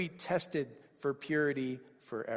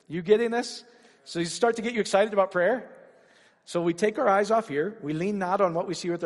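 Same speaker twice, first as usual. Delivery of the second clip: rising, louder preacher's climax, pitch climbing; preaching at full force; 5 semitones higher, 6 dB louder than usual. Acted. Preacher to the congregation, building to a loud climax. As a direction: drive it home to the congregation.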